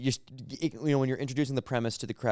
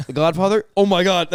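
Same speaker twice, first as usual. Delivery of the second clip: mock disbelief; 6 semitones higher, 7 dB louder than usual. Group chat mockery acted.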